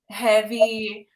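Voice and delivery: ordinary delivery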